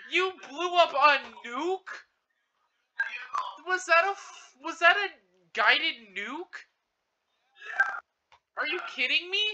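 shocked disbelief. Real, not acted. Shocked by nuke.

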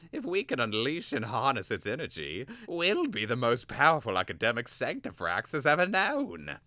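put-on high, eager young apprentice voice; Vilnix voice.

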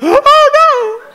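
high, loud hammed-up wail; overwhelming sadness. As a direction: wail in sudden mock heartbreak. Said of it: "felt overwhelming sadness".

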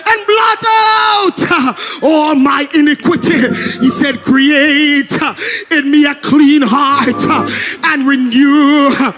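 sing-shout the psalm like a climax at the pulpit; impassioned pleading preacher, straining high; preaching at full force. Acted.

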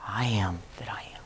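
"I am that I am" breathed as a whisper. Shown as a demonstration. Preacher showing the wrong way, whispered. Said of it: "he did not say".

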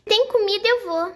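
put-on high excited kid voice; eager little girl. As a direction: jump at the food like a greedy kid. Doll eager for food.

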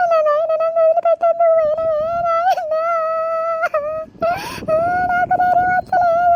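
high, loud crying plea; sobbing wail. Acted. Widow crying out to her dead husband, sobbing loudly. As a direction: break down sobbing, begging.

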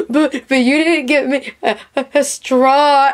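mock-tearful complaint to her husband, pitched high; imitated crying whine. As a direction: whine like a sick child who got no straw. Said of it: "I'm just crying because I don't have a straw".

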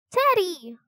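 high, excited cry of a name. A narrator's reading. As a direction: cry out high, calling a friend. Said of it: "cried Annie".

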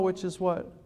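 ordinary talk

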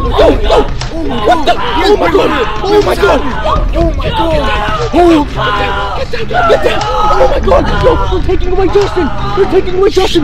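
frantic high yells; panicked screaming. Acted. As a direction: scream in terror. Friends being dragged away, panic.

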